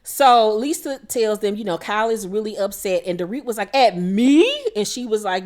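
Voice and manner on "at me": louder mock surprise. Dorit surprised at Kyle.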